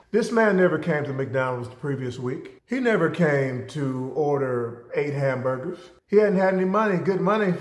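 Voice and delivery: ordinary delivery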